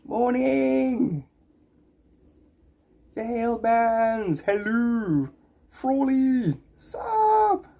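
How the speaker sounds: high, sing-song hellos to each name; cheery greetings. Real, not acted. Streamer welcoming several chat viewers, high cheery hellos. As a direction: toss out bright welcomes one by one.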